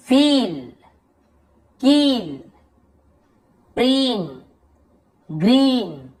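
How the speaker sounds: word list, each ee stretched with an arched pitch; drawn-out long ई. Shown as a demonstration. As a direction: model one word at a time, pausing between. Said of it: "थोड़ा लंबा खींच रहा हूँ". (हर शब्द में लंबी ई खींचकर)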